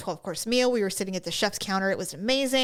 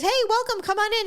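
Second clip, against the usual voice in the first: chef's welcome; 10 semitones higher, faster than usual. Retold or imitated. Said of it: "He goes".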